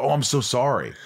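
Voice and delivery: mock English apology; English accent. Host imagining the actor slipping into English accent. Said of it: "accidentally jump in and go".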